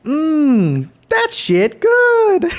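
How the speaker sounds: high, loud, drawn-out relish; YouTuber imitation. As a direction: savour it loudly, stretching the words. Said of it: "And he's like".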